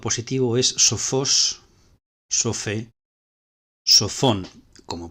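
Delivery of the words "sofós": "sofos" stressed on its last syllable, fos; stress on final syllable. Shown as a demonstration. Teacher citing a Greek adjective, "sofos" with final stress. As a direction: land firmly on the end of "sofos". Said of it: "que es oxítono".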